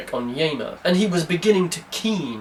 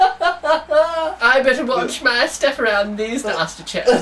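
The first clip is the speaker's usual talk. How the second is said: higher, laughing posh English voice; mock posh chap; 7 semitones higher than usual. Mocking the terrorist as a posh chap, laughing mock toff. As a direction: ham up a nervous toff. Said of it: "that's why he sounds like this".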